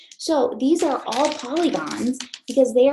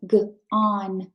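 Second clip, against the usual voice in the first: ordinary delivery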